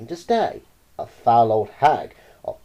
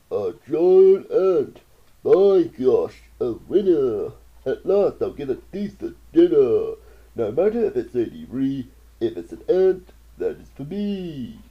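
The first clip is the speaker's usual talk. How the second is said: hungry anteater; 6 dB louder than usual. Acted. Anteater eyes the aunt as dinner, gloating. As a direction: relish the meal ahead. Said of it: "and mumbled".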